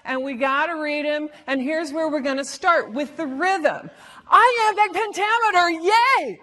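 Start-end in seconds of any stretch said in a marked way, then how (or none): 4.3-6.4 high, loud fake cheer; sarcastically over-the-top cheering; hammed-up enthusiasm, pitched up; mock excitement. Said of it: "said no one ever".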